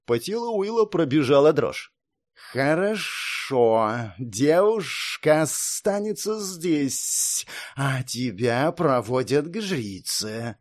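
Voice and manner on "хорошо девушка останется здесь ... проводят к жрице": slow, cold inhuman guard; measured, alien warrior tone; snake guard voice. Acted. Snake guard speaks slowly.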